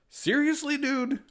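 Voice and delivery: high incredulous seriously dude; teasing disbelief; mock-indignant. Miles teasing Peter.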